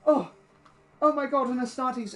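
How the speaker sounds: a touch of panic, overawed; panicked old man. Acted. Old man picks himself up before the Astartes, flustered awe. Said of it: "just panicked and withered".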